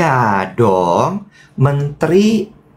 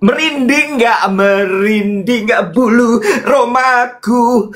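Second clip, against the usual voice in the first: higher, slow, hammed-up awe; mock dramatic thrill; 10 semitones higher, slower than usual. Host asking co-host, hammed-up high awestruck drawl. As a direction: stretch it out like a melodrama.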